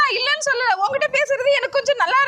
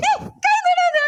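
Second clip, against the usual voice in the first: higher, tearful plea to stop; crying; 8 semitones higher than usual. Acted. Woman refusing the man's touch, crying. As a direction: cry out, pushing his hand away. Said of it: "அழுவாதடி".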